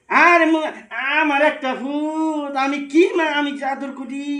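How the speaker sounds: high, drawn-out lament; stretched, high pleading cry; despairing wail. Acted. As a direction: wail it out in exasperation. Parent wails in despair at child.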